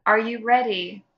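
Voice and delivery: ordinary talk, nothing marked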